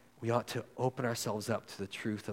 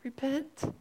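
climbing much higher with each repent; pleading call; 12 semitones higher, slower than usual. Acted. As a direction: urge them to turn back, begging. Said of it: "We should be like".

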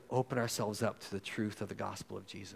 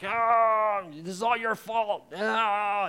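much higher and louder, bitter accusing cry; angry cursing; 11 semitones higher, 8 dB louder, much slower than usual. Acted. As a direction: hurl the blame at God in anger. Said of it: "cursing him back".

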